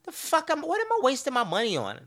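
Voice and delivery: high, disbelieving squeak of a thought; incredulous inner voice. Retold or imitated. Thinking about coffee prices, high incredulous disbelief. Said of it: "start to thinking, like".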